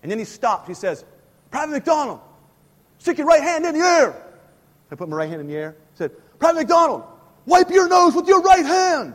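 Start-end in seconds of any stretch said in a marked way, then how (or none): 1.5-4.2 high loud barked command; drill sergeant order. Retold or imitated. 6.4-9.1 loud high barked order; drill sergeant shout. Retold or imitated.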